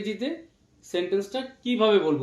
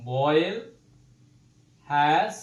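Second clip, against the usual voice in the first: slow dictation; much slower than usual. Shown as a demonstration. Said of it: "his or her".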